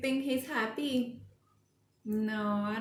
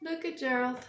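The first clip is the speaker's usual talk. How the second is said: usual speaking voice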